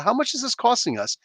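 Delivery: high-pitched cry without the volume; mock scream. Guy screams out at the table, high mock yell. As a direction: imitate screaming out, kept small. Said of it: "So this guy screams out".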